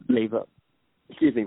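ordinary delivery

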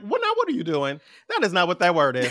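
high, amused telling-off; mock disbelief. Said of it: "And I'll be like".

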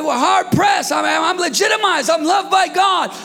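preacher's full shout; shouted declaration. Acted. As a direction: cry it out in triumph.

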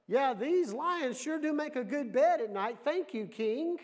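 high, loud breezy reply; lightly hammed-up, calling up high; cheerful Daniel. Daniel cheerfully answers the king.

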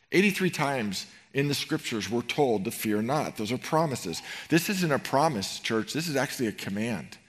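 ordinary talk, nothing marked

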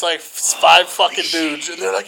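ordinary talk, nothing marked

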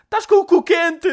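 high, loud, slow punchline into a laugh; granny cracking up at her own joke; high squealing mock-granny punchline; cackling old woman. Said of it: "ela riu-se".

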